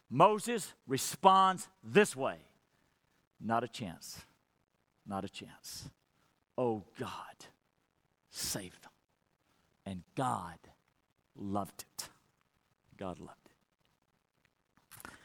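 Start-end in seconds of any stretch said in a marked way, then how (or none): 3.4-5.9 softer, low firm refusal; a quiet, immovable no. Retold or imitated. Moses refusing God's offer. 6.6-15.1 softer, low and slow; tender murmur of amazement; hushed awe. Real, not acted. Preacher marvels at God's delight.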